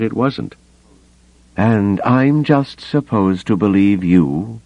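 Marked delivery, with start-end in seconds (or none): 1.6-4.6 slow, higher-pitched incredulous challenge; demanding. A narrator's reading. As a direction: demand it in drawn-out disbelief. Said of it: "he demanded".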